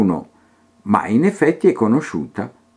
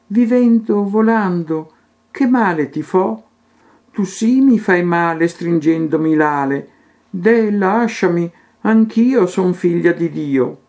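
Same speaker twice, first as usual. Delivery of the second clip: pleading; 6 semitones higher than usual. Acted. Butterfly begging Teresa to let go, high and pleading. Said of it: "A lei, supplicando, l'afflitta gridò".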